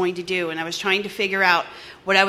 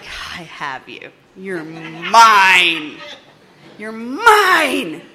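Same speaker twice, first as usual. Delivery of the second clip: mock sinister old-timer; 5 dB louder, much slower than usual. Voicing the old-timer's knowing smile.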